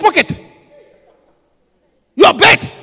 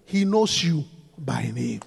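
soft, slow and lower; tender; 13 semitones lower, 11 dB quieter, slower than usual. Real, not acted. Preacher assures the congregation, slow and tender. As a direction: lay it gently on them. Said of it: "soft slow climax line".

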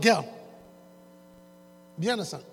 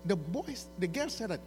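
normal talking voice

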